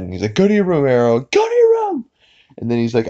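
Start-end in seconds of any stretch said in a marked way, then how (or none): 0.4-2.0 high cartoon scolding rising to a screech; imitated angry dad. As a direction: order a child away, getting shriller and higher. Acted angry cartoon father.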